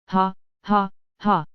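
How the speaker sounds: a short, strange witchy laugh; mocking cackle. A narrator's reading. Old woman cackling at the frightened grinder, witchy laugh.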